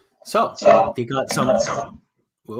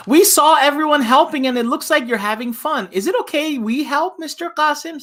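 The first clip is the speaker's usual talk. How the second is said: lightly voiced eager boy, pitched up; boy's voice; 11 semitones higher, 3 dB louder than usual. Teacher reading Walter asking Mister Qasim to help, lightly voiced eager boy. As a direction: ask brightly and a little higher, like a young kid.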